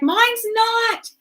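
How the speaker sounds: high-pitched panicky retort, imitated; mock hysterical. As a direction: mimic a stranger losing it. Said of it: "this lady was losing it".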